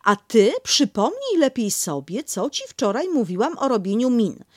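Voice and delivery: building to a firm order; Kanga scolding. Acted.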